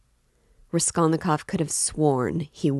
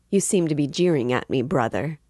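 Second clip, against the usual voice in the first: ordinary delivery, nothing marked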